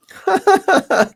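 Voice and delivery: big high laugh breaking out; loud burst of laughter. Real, not acted. Owner laughing at the puppy chewing her shirt.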